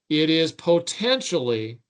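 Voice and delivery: potentially slowed and leaned on; slow, weighted potentially; stress on potentially. Real, not acted. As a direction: dwell on the word potentially to stress the doubt. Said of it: "and I want to emphasize the word potentially".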